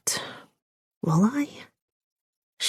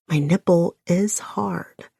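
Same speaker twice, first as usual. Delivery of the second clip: usual speaking voice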